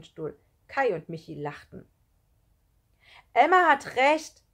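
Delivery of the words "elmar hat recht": loud, high, slow declaration; emphatic schoolgirl speaking up; sudden call. A narrator's reading. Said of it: "rief Elli plötzlich".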